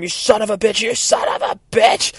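high, strained mock scream; acted scream. Girl hitting the ground, high acted scream. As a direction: imitate a furious scream of abuse. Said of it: "Boom, they scream".

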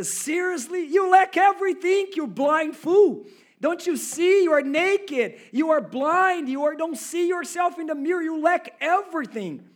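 shouted high, scolding a blind fool; furious rebuke. Pretend. Preacher voicing Jesus, shouting angrily at the rich young man. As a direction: yell it at the proud young man like an exasperated Jesus. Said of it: "if I was Jesus right now, I would say".

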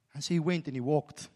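ordinary talk

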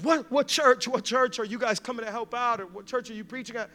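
high, loud start, settling as it goes; astonished questioning. Retold or imitated. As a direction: fire startled questions at the pastors. Acted man astonished, questioning the pastors.

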